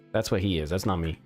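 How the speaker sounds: normal talking voice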